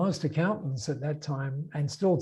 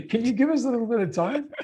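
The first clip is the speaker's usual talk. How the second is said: higher-pitched, louder quote through laughter; laughing quote; 8 semitones higher, 5 dB louder, faster than usual. Retold or imitated. Colleagues answering his sudden resignation, laughing. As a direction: laugh through a retold plea, fast and high.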